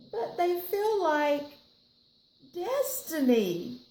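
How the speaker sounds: high, slow, wistful plea; dreamy begging for the shoes; pleading child. Acted. Nancy pleading with Mom for the shoes, high and slow. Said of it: "child begging mother".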